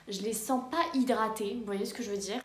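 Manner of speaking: echoey bathroom; room echo. Real, not acted. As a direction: voice bouncing off tiled walls. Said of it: "là ça résonne trop".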